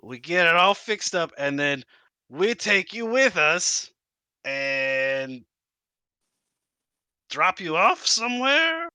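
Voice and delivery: high, pleading kobold voice. Acted. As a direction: sell the deal like a kobold. Kobold bargaining in voice.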